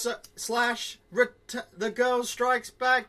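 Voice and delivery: high loud slow introduction; hype announcer. Acted. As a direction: introduce the guest like a ring announcer.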